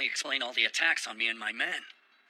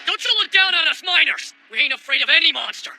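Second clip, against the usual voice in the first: angry shout; 12 semitones higher, 12 dB louder than usual. Acted. Miner offended by doubt.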